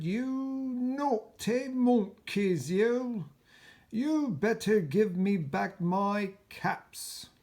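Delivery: loud, high, slow angry scolding; quite angry. Acted. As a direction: stamp your foot and scold. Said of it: "Now he felt quite angry".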